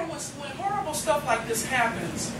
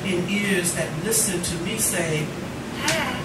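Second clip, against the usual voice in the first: poem recitation; 3 semitones lower, slower than usual. Acted. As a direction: read it tenderly to the room. Recites poem about student's dad.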